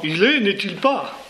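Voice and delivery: put-on high, slow, stiff English-accented French; mock English accent. Teacher voicing Astérix's Bretons, in a mock English accent. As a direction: put on a Briton's careful French for "n'est-il pas". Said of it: "Écoutons-les parler".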